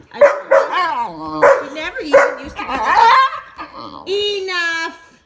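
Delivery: laughing through exasperation. Real, not acted. Owner laughing at her panicking dog. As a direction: laugh while scolding the dog.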